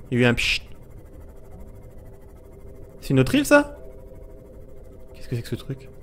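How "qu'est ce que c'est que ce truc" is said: hushed whisper to self; soft whisper of curiosity. Real, not acted. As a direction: breathe the question. Hushed wondering.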